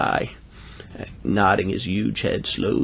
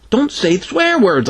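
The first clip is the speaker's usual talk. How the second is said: high, slow scolding; scolding kid; 11 semitones higher, slower than usual. Acted. Boy forbids Greta to swear, high emphatic scolding. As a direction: drag out the telling-off, high-pitched.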